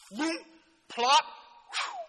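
spaced sound effects, whoosh, loud plop, airy whew; imitated splash. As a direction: act out a plunge, then the calm. Imitates the splash and sudden calm.

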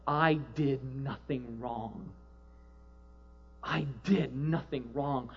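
soft, slow, broken repeating; mild tearful plea; retold crying. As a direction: quietly relive her tearful protest. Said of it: "She started crying".

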